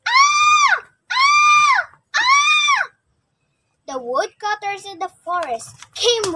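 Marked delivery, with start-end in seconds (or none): 0.1-2.9 very high, loud scream; shrill screams. Acted. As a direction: scream in terror again and again.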